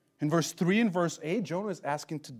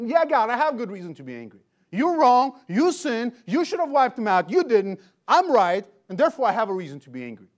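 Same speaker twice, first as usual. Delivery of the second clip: loud, high, indignant rant at God; defiant angry retort; 6 semitones higher, 8 dB louder than usual. Retold or imitated. Jonah shouting back at God, loud and indignant. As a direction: throw your self-righteous anger back at God. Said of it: "And he's saying".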